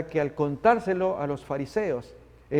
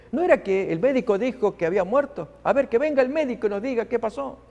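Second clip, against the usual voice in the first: higher, indignant grumbling; complaining relatives; 6 semitones higher than usual. Acted. Relatives complaining among themselves about the doctor, indignant. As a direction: demand answers from the doctor. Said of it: "quejándose entre ellos".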